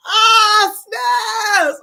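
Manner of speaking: high, drawn-out exclamation, cracked up; laughing hard. Real, not acted. Host whose cheeks hurt from laughing, laughing exclamation. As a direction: cry out, worn out by laughing. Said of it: "My cheeks are starting to hurt".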